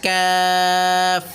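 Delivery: কাফ drawn out on a level tone; stretched vowel. Shown as a demonstration. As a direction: hold the "aa" of কাফ very long. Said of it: "এগুলো টানেন".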